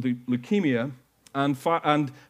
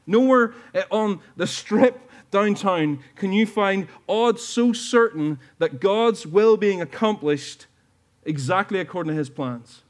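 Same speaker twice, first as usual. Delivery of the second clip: fervent preaching; 3 semitones higher, 4 dB louder than usual. Real, not acted. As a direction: drive each phrase home to the congregation. Preaching at full force.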